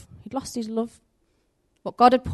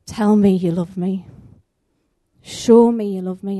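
louder, pleading call upward; crying out to God; 8 dB louder than usual. Retold or imitated. Desperate man crying out to God to show love, loud. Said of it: "I cried out to God".

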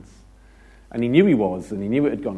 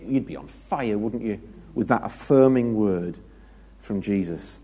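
normal talking voice

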